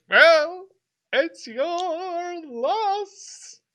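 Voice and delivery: high, drawn-out crooning; loony old-timer stretching every word; eccentric old man. Acted. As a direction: sing it out high and very slowly. Playing odd old man, pops mushroom.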